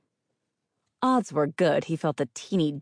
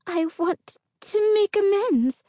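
very high, tight, slow plea; 5 dB louder, slower than usual. A narrator's reading. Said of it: "Her voice grew higher, tighter".

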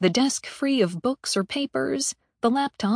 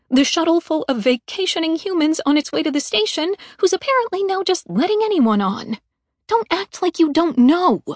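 higher and louder, building accusation; rising anger; 6 semitones higher, 5 dB louder than usual. Acted. Mallory accusing the ambassador of hiding it, heated. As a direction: push harder as she accuses.

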